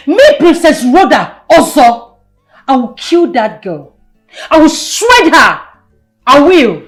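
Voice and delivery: loud, wounded royal fury; furious, emphatic vow; enraged threat. Acted. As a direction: burst out with vengeful outrage. Enraged princess threatens rival.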